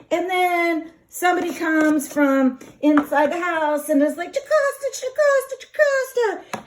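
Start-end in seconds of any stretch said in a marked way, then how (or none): 4.3-6.6 high repeated call. Retold or imitated. Servant calls for Jocasta from the house, high and urgent. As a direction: cry the name out from inside the house.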